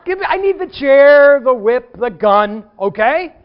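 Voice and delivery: loud, high comic yelling; mock panicked shouting. Pastor to the man with a chair, mock shouting. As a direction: yell it in hammed-up alarm.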